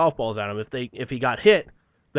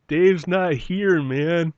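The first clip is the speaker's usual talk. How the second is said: slow, higher stoner drawl through a laugh; mock stoner; 5 semitones higher, 4 dB louder, much slower than usual. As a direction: play a dazed stoner, slow and amused. Stoner movie quote, laughing.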